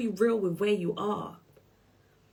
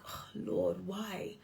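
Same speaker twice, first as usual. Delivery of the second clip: soft despairing plea; 9 dB quieter, much slower than usual. Retold or imitated.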